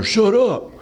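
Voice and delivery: high, mock "Shut up"; a hinted harsh shut-up; mock snap. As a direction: imitate a rude retort, lightly. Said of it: "I'm not telling her".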